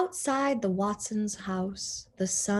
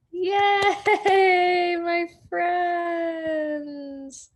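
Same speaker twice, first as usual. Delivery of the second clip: loud, high, happy shout; excited cheer; 10 semitones higher, 6 dB louder, much slower than usual. Real, not acted. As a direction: cheer to the listeners. Cheering at story's end.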